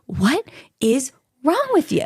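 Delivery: mock-appalled retort, spaced out; appalled disbelief. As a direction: stretch each word in exasperation.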